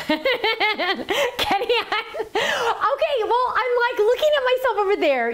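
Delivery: very high, giggly explanation; laughing. Real, not acted. Explaining to the camera operator where she's looking, giggling high. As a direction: struggle to talk through real laughter.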